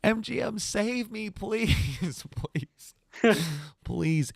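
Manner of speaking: real playful begging for the job to come through; laughing plea. Real, not acted. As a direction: plead to the company, grinning.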